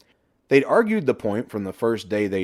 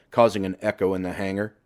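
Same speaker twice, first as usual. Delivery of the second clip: ordinary delivery, nothing marked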